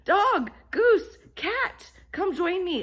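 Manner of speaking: high, loud call to the barnyard. Acted. Hen calls her friends to help unload, loud high call.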